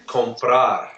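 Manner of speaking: comprar said slowly, stressed on final PRAR; end stress. Shown as a demonstration. Teacher prompting students to repeat comprar, slow, end-stressed on PRAR. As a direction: stretch comprar and land on com-PRAR. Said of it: "putting the stress at the end".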